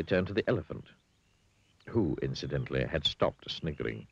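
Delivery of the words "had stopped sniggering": ordinary delivery, nothing marked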